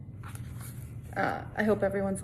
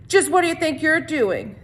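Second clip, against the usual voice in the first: higher, louder challenge to the bully; confronting a bully; 8 semitones higher, 9 dB louder than usual. Acted. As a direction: call him out sharply. Squid confronts bully Derek.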